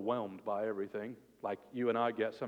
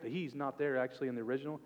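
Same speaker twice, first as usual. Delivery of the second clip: normal talking voice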